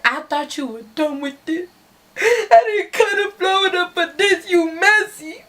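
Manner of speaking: high, teary mock sobbing voice; mock crying. The crying boyfriend accusing his girlfriend, mock sobbing. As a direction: whine it out like a crybaby. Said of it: "starts crying".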